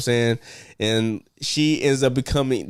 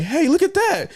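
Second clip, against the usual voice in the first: much higher, falsely innocent; fake casual. Dad pointing his daughter at the stage, mock innocent high. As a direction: act breezy to distract her. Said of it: "He like".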